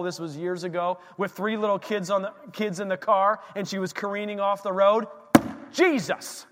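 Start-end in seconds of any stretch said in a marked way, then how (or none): 5.7-6.4 sharp, high cry of Jesus!; wife crying out as the car spins. Retold or imitated. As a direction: call out in sudden panic. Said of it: "That's all she said".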